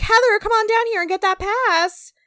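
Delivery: high, loud game-show style call; mock super-chill Brett. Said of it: "Brett's super chill and he's like".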